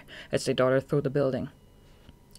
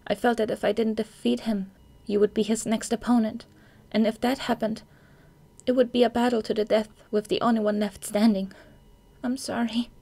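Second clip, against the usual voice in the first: put-on higher girl voice, apologetic at the end; 7 semitones higher than usual. Kyoka explains herself.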